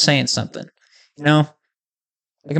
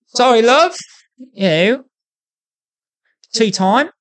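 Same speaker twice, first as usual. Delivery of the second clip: put-on higher-pitched British accent, slipping into Australian; bad British accent; 8 semitones higher than usual. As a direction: put on a cheeky, badly faked British accent. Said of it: "they would have British accents doing it".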